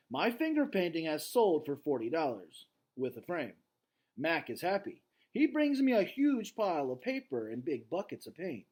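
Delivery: story reading. A narrator's reading. Teacher reading the novel aloud to class, lively read-aloud. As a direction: read the chapter with energy to students.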